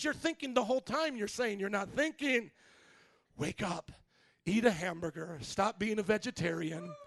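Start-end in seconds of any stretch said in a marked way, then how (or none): none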